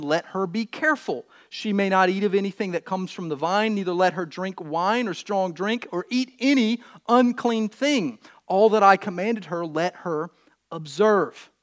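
projected, measured reading with weighted key words; scripture reading. Acted. Preacher reads Samson's birth story, scripture reading. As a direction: proclaim the Bible story to the room.

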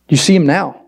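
ordinary talk, nothing marked

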